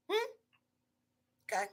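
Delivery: normal talking voice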